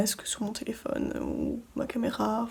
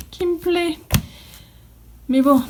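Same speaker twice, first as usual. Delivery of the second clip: ordinary delivery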